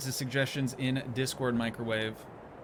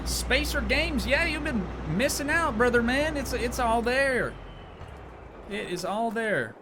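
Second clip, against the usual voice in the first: put-on country accent; 10 semitones higher than usual. Streamer plugging his playlist to chat in a hammed-up Southern country accent. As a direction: ham up a Southern country voice for the fans. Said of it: "Your country accent's very convincing".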